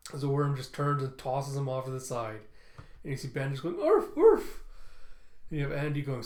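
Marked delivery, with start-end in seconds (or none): 3.8-4.6 dog bark. Retold or imitated. Bandage the robot dog barking.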